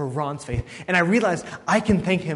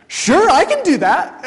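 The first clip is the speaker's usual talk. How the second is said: high, loud, drawn-out enthusiastic yes; eager agreement; 12 semitones higher, 9 dB louder than usual. Retold or imitated. Preacher acting out his reply to Ron's invitation to study, eagerly agreeing. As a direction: jump at the offer, pitched way up and loud.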